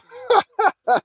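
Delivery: high, loud cackling laugh; real burst of laughter. Real, not acted. Laughing at viewer's timeout.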